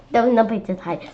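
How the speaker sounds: plain reading voice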